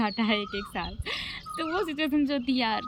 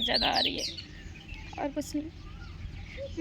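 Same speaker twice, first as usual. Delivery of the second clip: very slow, much softer, choked words; 5 semitones higher, 6 dB quieter, slower than usual. Real, not acted. Missing her mother, choked and quiet. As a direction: trails off holding back tears. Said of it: "मुझे रोना कैसे आ जाता है".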